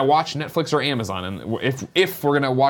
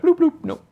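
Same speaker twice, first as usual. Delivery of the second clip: high squeaky computer bloops; imitating a cut-off stream's bloop sound; mock error beep. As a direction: beep like a dead app. Mocking a streaming service shutting off.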